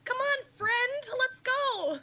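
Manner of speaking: squeaky cartoon Canadian, sweet as pie while tossing someone out; South Park cartoon voice. Imagining her friend as a gentle bouncer, in a high South Park voice. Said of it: "I just went straight South Park on that shit".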